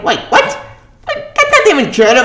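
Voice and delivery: squeaky high disbelieving what; shocked double take. Real, not acted. To viewers, disbelieving a plot twist, squeaking high. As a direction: react like you misread it.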